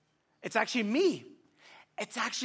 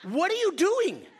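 put-on high, louder exasperated yell at the kids; mock yell at dawdling kids; parent's high exasperated yell; exasperated shout; 10 semitones higher, 5 dB louder than usual. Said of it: "And I'm like".

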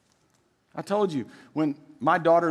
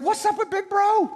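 much higher, put-on servant voice; excited servant. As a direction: squeal the gossip like an excited servant. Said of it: "they're like".

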